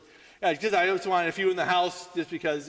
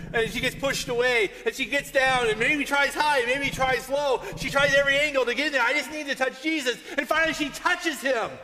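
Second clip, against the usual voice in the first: building urgency; 7 semitones higher, 5 dB louder than usual. A narrator's reading. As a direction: build steadily louder and higher to the climax. Building retelling of the crowd struggle.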